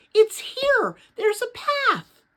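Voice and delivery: high, loud and thrilled, slowing on path; excited cry. A narrator's reading. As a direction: cry out the discovery. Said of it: "she cried".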